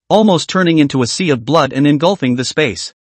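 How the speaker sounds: usual speaking voice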